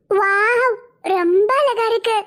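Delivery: put-on high, childish little girl voice, slow and sweet. Small girl asking Nila akka to play, in a high childish voice.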